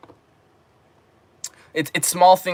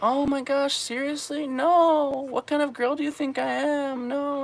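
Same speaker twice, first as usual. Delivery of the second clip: put-on high, soft falsetto girl protest; hammed-up coy girl; giggly mock protest; 14 semitones higher, 5 dB quieter than usual. Imitating a giggling girl pretending to refuse, high falsetto. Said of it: "kind of giggling".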